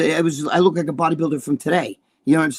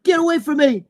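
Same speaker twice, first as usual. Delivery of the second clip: high shout pushing them off; upset snap; 10 semitones higher, 3 dB louder than usual. Retold or imitated. Crying player driving away his teammates, high upset shout. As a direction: yell it like a hurt kid. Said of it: "And I'm like".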